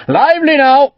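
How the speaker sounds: high, loud deck shout. A narrator's reading. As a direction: call it out over the wind. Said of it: "Cromwell shouted".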